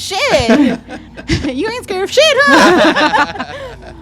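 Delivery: laughing out a ribbing question. Real, not acted. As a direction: tease through real laughter. Laughing teasing her friend.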